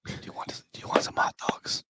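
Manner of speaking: breathy hushed offer; movie theater whisper. Pretend. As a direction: lean over and whisper the offer. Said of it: "you can whisper in the movies".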